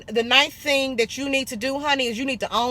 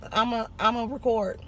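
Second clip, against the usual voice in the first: usual speaking voice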